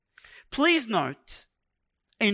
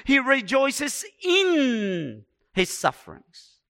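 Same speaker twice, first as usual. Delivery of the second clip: "in" drawn out long and high; slow build to a stretched, emphatic "in"; stress on IN; 4 semitones higher, 3 dB louder, slower than usual. Real, not acted. Contrasts into, away from, in.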